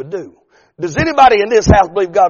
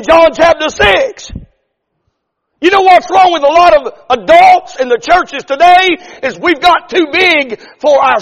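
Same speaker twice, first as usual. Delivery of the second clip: higher, louder preaching, slowing on the key line; full-force preaching; 10 semitones higher, 6 dB louder than usual. Acted. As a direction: drive the point home to the congregation.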